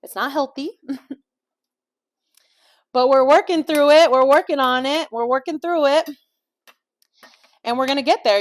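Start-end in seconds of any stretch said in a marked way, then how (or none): 2.9-8.4 louder, higher pep talk; rally yourself, cheerfully; upbeat encouragement. Real, not acted.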